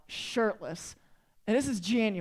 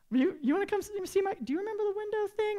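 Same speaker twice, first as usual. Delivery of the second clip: high-pitched, halting disbelief; incredulous; 10 semitones higher, faster than usual. Retold or imitated. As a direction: squeak it out, stammering in disbelief. Acted disbelief at friend's text.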